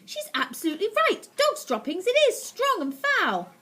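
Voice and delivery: very high, loud witch; excited witch voice. Acted. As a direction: gloat with disgust. Witch agrees.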